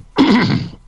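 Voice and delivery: loud, theatrical throat clear. Acted. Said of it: "excuse me while I clear my throat".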